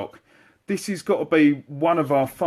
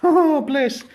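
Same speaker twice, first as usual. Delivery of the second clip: cooing; 14 semitones higher, 5 dB louder than usual. Real, not acted. Cooing over a cute gun.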